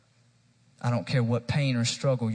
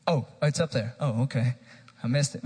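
normal talking voice

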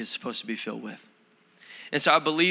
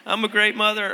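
mock laughing mom; 11 semitones higher than usual. Mom gloating to her kids about the prank, mock laughing. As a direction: put on a high, gleefully silly mother. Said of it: "laughing, you know, she".

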